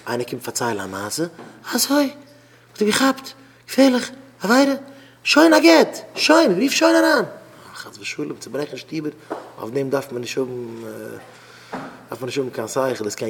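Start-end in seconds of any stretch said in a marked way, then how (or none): 1.7-7.3 high, loud exclamations in short bursts; alarmed outrage. Acted. Adviser reacting to someone's story, alarmed high outcry. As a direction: act scandalised, pitched right up.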